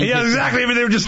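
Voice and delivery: normal talking voice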